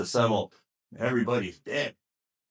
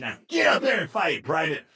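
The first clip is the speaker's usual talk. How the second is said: higher-pitched acted command; barked sergeant's order; 5 semitones higher, 4 dB louder than usual. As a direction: order a reluctant soldier into battle. Sergeant orders scared private into battle.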